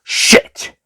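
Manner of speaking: hissed, breathy swear; the Master caught by the Doctor, swearing; sharp curse. A narrator's reading. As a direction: spit out the curse. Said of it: "the master swore".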